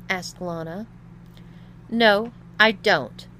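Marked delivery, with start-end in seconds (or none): 1.9-3.3 loud, slow, emphatic denial; spat out. A narrator's reading. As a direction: spit out each word in irritation. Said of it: "spat Tom".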